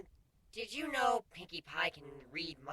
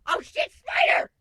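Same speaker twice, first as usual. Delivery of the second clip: much higher, loud, slow yelp of fright; startled scream of terror; panicked shriek; 13 dB louder, slower than usual. Acted. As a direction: shriek as the spider appears. Changeling panics at the spider.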